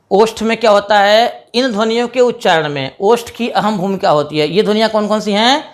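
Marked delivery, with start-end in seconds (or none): none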